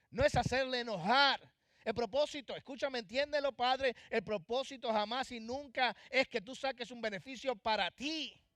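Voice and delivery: loud, fervent sermon voice, pressing each point; preaching at full force. Acted. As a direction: preach it hard to the parents. Pastor preaching to parents. (predicando con toda la fuerza, en voz alta)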